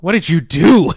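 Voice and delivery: loud, high mock outrage; appalled. Friends at the window confront Fry, loud appalled imitation. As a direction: cry out in hammed-up horror. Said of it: "they're, like, all appalled".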